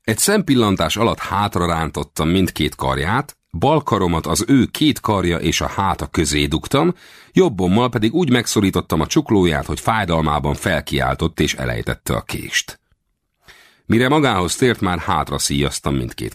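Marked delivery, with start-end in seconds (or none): none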